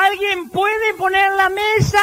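high slow call to the household; mock exasperated shout; wife shouting. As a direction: call out high for someone to set the table. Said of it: "ella grita".

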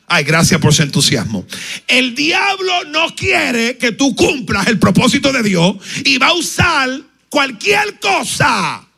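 loud, slow, fervent preaching; a pastor warning his congregation, loud and fervent; preaching at full force. Real, not acted. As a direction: drive the warning home to the congregation.

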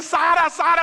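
high, loud cry of a name; shouting. Acted. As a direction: yell for your lost child. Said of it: "empecé a gritar". (gritando angustiado)

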